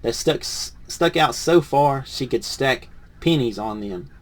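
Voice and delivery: lively picture-book read-aloud; reading to children. Acted. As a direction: read it to a class of kids.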